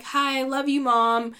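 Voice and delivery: lightly imitated daughter addressing her mother; lightly voiced Kim. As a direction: lift the pitch for a quoted hello to mom. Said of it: "Kim can be like".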